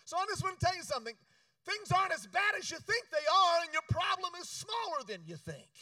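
high, loud, fast preaching build; fiery preaching. Acted. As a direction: preach it out to the crowd. Preaching at full force.

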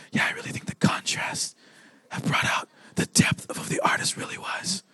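earnest mock-connoisseur whisper; whispered art critique. Second gallery visitor chiming in on a painting, whispering. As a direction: whisper your learned opinion reverently. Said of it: "the other person starts chiming in".

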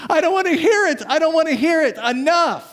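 put-on high-pitched childish protest over the top of the other person; kid refusing to listen. Preacher acting out the livid council like kids covering their ears, high childish refusal. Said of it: "like your kids do sometimes".

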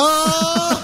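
high, sudden "Aa!" of astonishment; mock surprised gasp. Imitating a startled car owner hearing the engine. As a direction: gasp as if the engine just roared. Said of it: "Ne diye bağırıyor mesela?".

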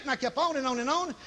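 usual speaking voice

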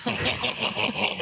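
evil laugh. Pretend. Demon voice laughs.

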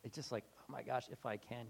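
low, soft disbelieving echo; exasperated Jesus. Retold or imitated. Jesus echoing the father's doubt, low and soft. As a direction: repeat his words back with weary disbelief. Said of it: "He's like".

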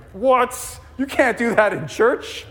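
mock outrage. Pastor voicing panicked congregants, high and scandalized. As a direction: play the shocked churchgoer for laughs. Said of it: "being like".

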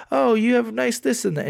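high fawning sales pitch; mall kiosk seller. Retold or imitated.

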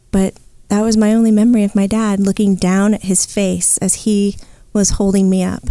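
ordinary talk, nothing marked

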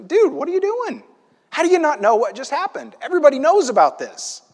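high-pitched, exasperated mock scolding; berating. Traveler to a stranger who missed the news, berating. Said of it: "basically kind of berating Jesus like".

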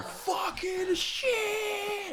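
high, drawn-out screaming curse; pained scream. Retold or imitated. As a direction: scream it like spray just hit your face. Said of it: "and you just hear".

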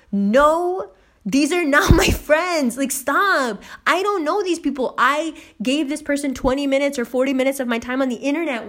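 high-pitched, exasperated protest; retold indignant denial. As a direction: disown them emphatically, pitch up. Denying they are friends.

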